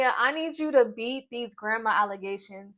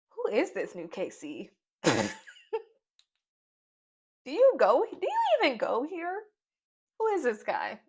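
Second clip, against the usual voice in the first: incredulous; 4 semitones higher, 4 dB louder than usual. Retold or imitated. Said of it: "first thing I asked myself".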